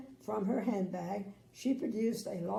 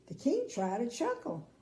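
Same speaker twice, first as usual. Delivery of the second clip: plain reading voice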